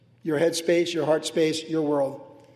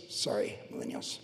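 hushed, very low mock apology; joking aside; 13 dB quieter than usual. Real, not acted. Preacher teasing millennials in his congregation, hushed joking aside. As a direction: toss it away under your breath.

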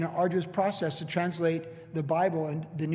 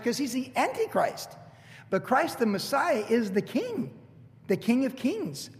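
rising preaching; 5 semitones higher than usual. Acted. Pastor proclaims Christ the King to congregation, soaring preach. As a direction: build the climax, stretching each king.

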